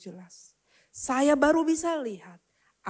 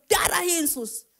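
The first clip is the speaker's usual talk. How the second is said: retold cry of alarm, higher and louder; seruan kaget; 6 semitones higher, 5 dB louder than usual. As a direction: blurt out a startled plea to Jesus. Cries out as truck nearly hits. (seruan kaget minta perlindungan)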